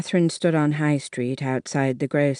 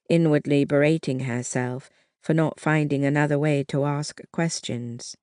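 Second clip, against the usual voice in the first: plain reading voice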